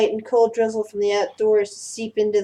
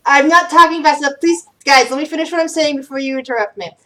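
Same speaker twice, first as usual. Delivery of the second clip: raised, higher voice over interruptions; exasperated plea; 5 semitones higher, 5 dB louder than usual. Real, not acted. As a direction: plead firmly to be allowed to finish. GM pleads with interrupting players.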